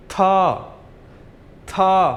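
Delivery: था with an aspirated t; pinyin t as th. Shown as a demonstration. Teacher showing pinyin t, aspirated t in था. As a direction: puff air through the t of था. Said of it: "टी को हम बोलेंगे था".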